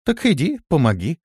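high, offhand brush-off; dismissive. A narrator's reading. Листи brushing off her friend's plea, dismissive. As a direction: wave the request away. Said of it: "отмахнулась Листи".

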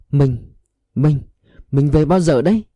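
stammering husband, voice rising at the end. A narrator's reading. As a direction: trip over the first word, repeating it. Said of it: "Ông Huệ lắp bắp".

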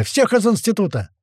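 high-pitched urgent order; shouting. A narrator's reading. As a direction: call for everyone to get out. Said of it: "крикнул Гера". (высокий срочный выкрик)

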